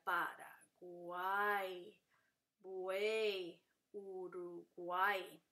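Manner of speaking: Paraguay, buey, Uruguay slow and low, final vowels held together; syllable by syllable. Shown as a demonstration. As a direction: stretch each syllable, keeping uay and uey whole in Paraguay, buey, Uruguay. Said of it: "Entonces no se separan, sería". (Paraguay, buey, Uruguay lentas, sin separar el triptongo)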